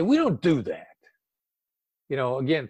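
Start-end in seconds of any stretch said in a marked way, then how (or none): 0.0-0.9 a sharp, higher, louder "We don't do that."; insulting tone. Shown as a demonstration. Negotiator demonstrating an insulting refusal, sharp and raised. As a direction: snap "We don't do that." at them like a rebuke. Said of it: "tone of voice is insulting".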